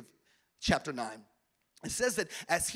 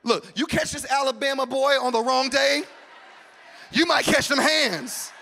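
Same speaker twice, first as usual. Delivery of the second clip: mock tough threat; 5 semitones higher, 11 dB louder than usual. Playful threat, loud.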